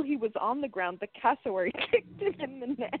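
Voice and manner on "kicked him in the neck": real laughter breaking into the sentence; laughing while reading. Real, not acted. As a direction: read it out while cracking up. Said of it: "You are laughing at something".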